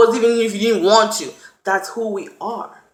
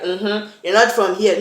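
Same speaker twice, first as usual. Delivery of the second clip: dismissive. Retold or imitated. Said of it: "make you feel like".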